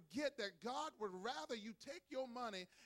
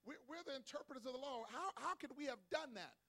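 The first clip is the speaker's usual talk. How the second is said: higher-pitched mock protest; indignant protesting Pharisees; 5 semitones higher than usual. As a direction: act out the baffled objection. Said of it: "They're like".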